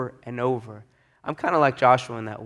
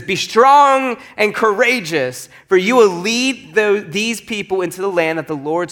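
emphatic scripture reading; 5 semitones higher, 3 dB louder than usual. A narrator's reading. Proclaiming Moses' charge from scripture.

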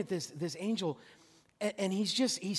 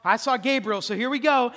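Daniel bracing himself; 4 semitones higher, 7 dB louder than usual. Retold or imitated. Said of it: "he's like".

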